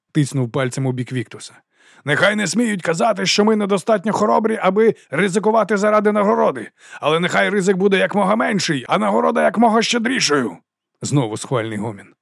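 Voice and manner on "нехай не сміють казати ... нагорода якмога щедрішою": high, sustained rallying speech; ringing oration. A narrator's reading. Captain praises the mercenaries' skill, ringing oratory. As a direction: proclaim the troops' prowess. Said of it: "Його голос дзвенів".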